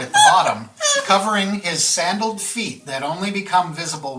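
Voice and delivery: game master's scene setting. A narrator's reading. Game master describing a character to the players, measured narration. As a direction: paint the picture phrase by phrase, with pauses.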